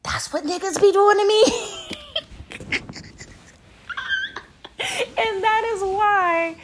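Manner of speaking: high hammed-up fake sob; mock crying. Mock crying over what men do to her. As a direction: wail it like fake tears.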